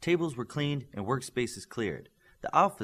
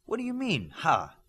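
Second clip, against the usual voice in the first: high surprised question; 8 semitones higher, 3 dB louder than usual. Acted. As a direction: ask it in startled disbelief. Character reacting surprised.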